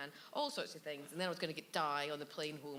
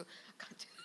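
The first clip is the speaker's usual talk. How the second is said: whispered aside; 14 dB quieter than usual. Retold or imitated. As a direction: mock-desperate whisper. Retold aside during filming.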